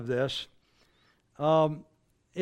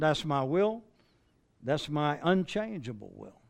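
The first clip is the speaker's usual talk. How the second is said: ordinary delivery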